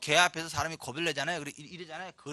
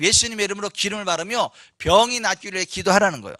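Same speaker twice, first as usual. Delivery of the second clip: ordinary talk, nothing marked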